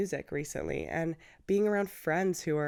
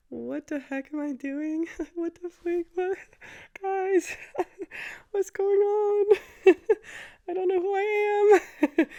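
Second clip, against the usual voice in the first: much higher, laughing through a mock breakdown; laughing mock panic; 12 semitones higher, 7 dB louder, slower than usual. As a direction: squeal out your confused couch crisis with giggles. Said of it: "sitting on my couch like".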